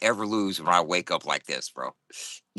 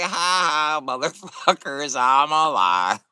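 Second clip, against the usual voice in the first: gleeful crowing; 6 semitones higher, 7 dB louder, slower than usual. Retold or imitated. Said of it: "I wake up laughing my ass off".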